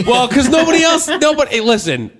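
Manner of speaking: loud and high, laughing defensively; loud laughing protest. Real, not acted. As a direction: protest loudly through a laugh. Defending himself, laughing loudly.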